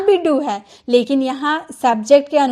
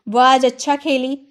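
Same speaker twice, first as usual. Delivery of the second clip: ordinary delivery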